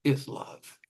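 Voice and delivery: slow measured poetry reading; poem reading. Acted. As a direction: read it like a poet, unhurried. Poet reads own poem.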